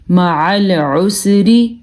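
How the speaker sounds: slow معل عسری with two distinct throaty ع; both ains clear. Shown as a demonstration. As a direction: squeeze out each ع in معل عسری separately. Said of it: "وضاحت کے ساتھ پڑھا". (معل عسری، دونوں عین واضح)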